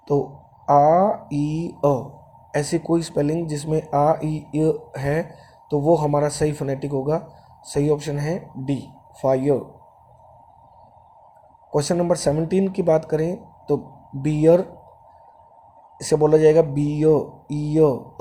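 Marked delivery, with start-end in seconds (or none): 0.7-1.9 "आ ई अ" drawn out slowly, one vowel at a time; separated vowel sounds. Shown as a demonstration. Teacher breaking a word into its sounds for students, separated vowels. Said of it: "कौन कौन से sound है". 16.6-18.2 "बियो" ending in an open vowel, no R; silent final R. Shown as a demonstration. Teacher showing how beer is to be said, silent final R. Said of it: "र बोला नहीं जाता".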